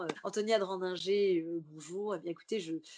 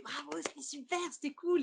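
imitated gushing praise; 6 semitones higher than usual. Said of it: "Aurore me regarde, me fait".

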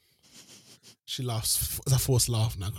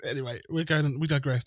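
usual speaking voice

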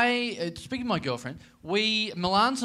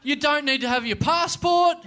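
loud high mock sales pitch; 12 semitones higher, 7 dB louder than usual. Real, not acted. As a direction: sell the stairs like a bargain.

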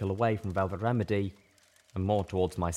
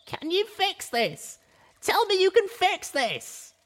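high, slow, pleading then louder demand; desperate demand; 4 dB louder, slower than usual. Acted. Little Pip demanding Velvet fix her cutie mark, desperate. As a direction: beg her to fix it, rising into a cry. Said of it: "I demanded".

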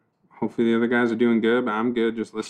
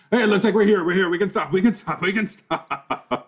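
panicked high plea; 9 semitones higher, 4 dB louder than usual. Acted. Desperate plea to stop car.

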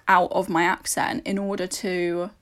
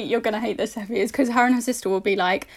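ordinary talk, nothing marked

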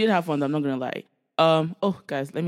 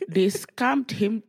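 slow emphasis; 5 semitones higher, much slower than usual. Real, not acted. Emphasizing the scam.

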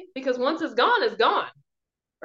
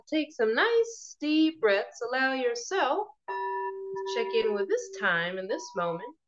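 guided meditation; 4 dB quieter than usual. Acted. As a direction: ease them gently into calm.

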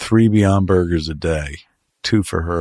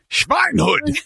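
put-on harsh comic German accent, pitched up; hammed-up German accent curse. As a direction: throw it out as a mock German insult. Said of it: "a German accent".

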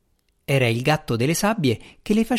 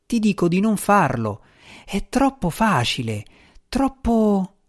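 higher, uneasy cat spirit voice; fretful little djinn, unsure; nervous djinn cat; 7 semitones higher than usual. Acted. Il gatto insiste. (gatto djinn inquieto, voce più alta)

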